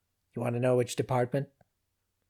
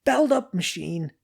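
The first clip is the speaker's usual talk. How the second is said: yelling; 6 semitones higher, 6 dB louder than usual. A narrator's reading. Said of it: "yelled Zenda".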